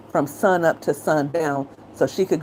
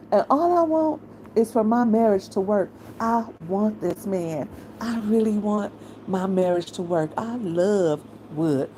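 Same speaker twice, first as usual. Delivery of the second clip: imitating Regina; 5 semitones higher than usual. Vlogger mocking how Regina talks about her marriage, lovesick mimicry. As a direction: parrot her speech as if making fun of it. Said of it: "That's how she talks".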